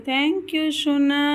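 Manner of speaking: long, stretched-out thanks to a little one; drawn-out praise. Real, not acted. Mother thanks her child for moving pillows, drawn-out praise.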